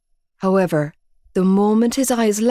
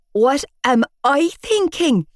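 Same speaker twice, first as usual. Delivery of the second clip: strangled out; 7 semitones higher than usual. A narrator's reading. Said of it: "I strangled out".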